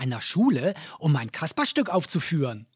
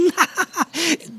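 high silly clown giggle; 9 semitones higher than usual. Acted. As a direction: giggle at your own joke. Kasper giggles after joke.

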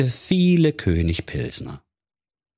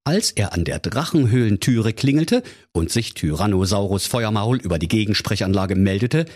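plain reading voice

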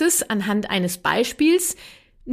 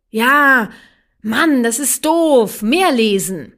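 put-on angry child whine; 3 semitones higher, 7 dB louder, much slower than usual. Mother acts the child demanding more reading, loud and whiny. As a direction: stretch it out like a cross kid.

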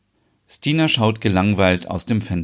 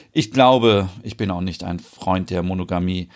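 ordinary talk

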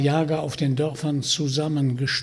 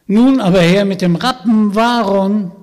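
much louder firm demand; 5 semitones higher, 10 dB louder than usual. Acted. Vanya demanding the raven horse from the witch, loud.

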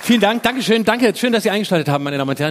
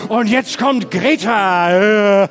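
imitating a TV host; much slower than usual. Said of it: "deren Moderator sagt".

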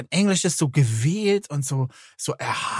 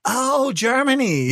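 enthusiastic American; 7 semitones higher, slower than usual. Retold or imitated. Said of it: "they always ask where you're from".

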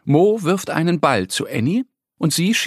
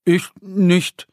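stammering; 5 semitones higher than usual. Acted. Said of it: "stottert Mo".

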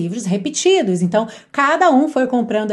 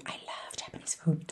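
breathy whisper, much softer; 7 semitones lower, 17 dB quieter than usual. Acted. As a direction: whisper it like a secret. Acted mini dialogue answer.

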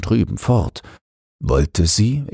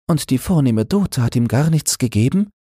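put-on higher girl's voice, asking in surprise; a curious girl, pitched higher, puzzled; Christine asking; 10 semitones higher than usual. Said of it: "fragte Christine". (Christine, höhere Mädchenstimme, verwundert fragend)

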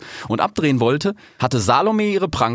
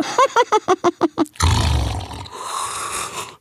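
high hyena cackle, ending in a long yawn. A narrator's reading. Hyena ends her apology, cackling then yawning. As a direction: laugh in pulses, then yawn audibly. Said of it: "sagte sie und gähnte".

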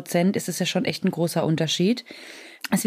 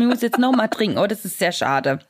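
usual speaking voice

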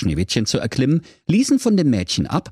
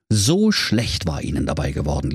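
usual speaking voice

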